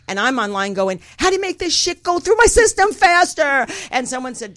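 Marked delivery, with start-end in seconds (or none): 1.2-3.7 loud, high, frantic retold outburst; hammed-up anxious shout, pitched way up; panic-stricken. Searching online while too high, hammed-up panic. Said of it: "panic-stricken, anxiety-ridden".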